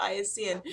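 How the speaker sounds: "Bayesian" echoed as BYE-uh-see-un; "Bayesian" with first syllable "bye" and an "s" sound; copied pronunciation. Retold or imitated. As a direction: echo "Bayesian" starting like "bias". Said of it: "I always say Bayesian".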